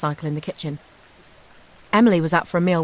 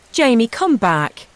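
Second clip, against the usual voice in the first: loud, high, slow call after him; 6 semitones higher, 6 dB louder, slower than usual. A narrator's reading. Mother calls after her son jogging down the path, loud and high. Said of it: "I called out".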